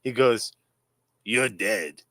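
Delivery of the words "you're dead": put-on heavy Russian accent, low and flat; deep Drago-style Russian threat. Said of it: "a real heavy Russian accent".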